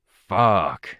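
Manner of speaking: a loud, drawn-out fuck stretched over two syllables; emphatic curse. A narrator's reading. Tristan hearing the bad news, cursing with emphasis. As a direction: spit it out with real emphasis. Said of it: "with some emphasis and at least two syllables".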